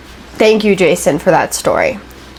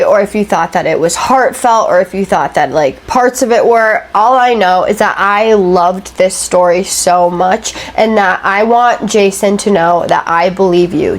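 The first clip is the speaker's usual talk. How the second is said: plain reading voice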